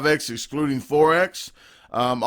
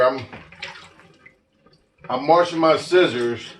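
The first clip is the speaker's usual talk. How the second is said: ordinary talk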